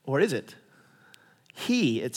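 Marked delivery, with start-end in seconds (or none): none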